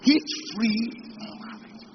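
slow stretched hitch-free; drawn-out emphasis. Acted. Preacher savouring his wish, slow and stretched. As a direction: draw out hitch-free slowly. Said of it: "preacher savours phrase".

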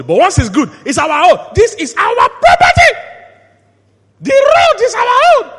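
high, full-voiced shout of ownership; possessive crowd shout. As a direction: build to a high-pitched mock-boastful yell. Mimics public eagerly claiming property.

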